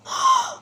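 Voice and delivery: high sudden gasp of wonder; sharp gasp. A narrator's reading. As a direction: gasp in amazement. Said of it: "Aladdin gasped".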